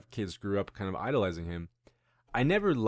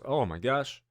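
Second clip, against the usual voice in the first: ordinary delivery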